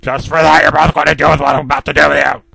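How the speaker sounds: loud, raging letter read in a put-on voice; enraged villain yelling threats; furious rant. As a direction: scream it at the ones who ruined the garden. Mister Grumpy's furious letter voiced.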